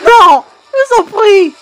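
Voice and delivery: loud, high real pleading; begging. Real, not acted. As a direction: beg the screen for mercy. Begging the monster to spare Junior.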